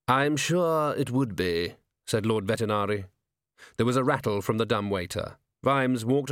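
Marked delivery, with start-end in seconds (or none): 0.1-1.7 slow, drawn-out lordly voice; dry patrician. Acted. Bedridden lord replying to the commander, slow and dry. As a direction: answer with slow, measured politeness.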